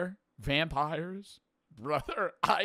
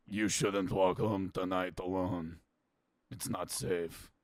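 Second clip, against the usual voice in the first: lower, slow, foreboding murmur; ominous local warning; 8 semitones lower, 3 dB quieter, slower than usual. Retold or imitated. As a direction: warn the stranger like a grim villager. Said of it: "a local leans in and goes".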